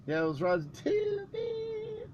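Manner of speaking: squeaky-pitched cartoon rabbit bit; Roger Rabbit voice. Recalling his first impression, high Roger Rabbit cartoon voice. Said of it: "I did Roger Rabbit first".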